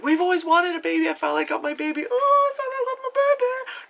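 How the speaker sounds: high squeal building to a wail; over-the-top delirious joy; mock gushing parent.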